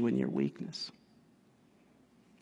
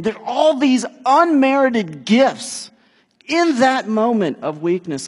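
preaching swell; 8 semitones higher, 8 dB louder than usual. Acted. Preaching climax.

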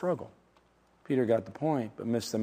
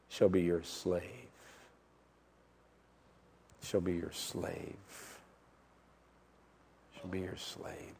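low, hushed repeat, softer each time; fading repetition; 8 semitones lower, 13 dB quieter than usual. Real, not acted. Preacher repeating a hard verse to congregation, hushed. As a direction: let it sink in, sinking toward near silence.

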